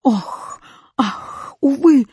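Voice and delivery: lament, building to a cry; wailing exclamation mark. A narrator's reading. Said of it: "закричал восклицательный". (причитания с нарастанием)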